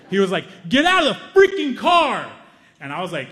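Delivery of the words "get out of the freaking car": high, slow furious shout at the car; angry yell. Retold or imitated. Road rager yelling at the family in their car, furious shout. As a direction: bellow it out like an enraged stranger. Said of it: "and he's pissed".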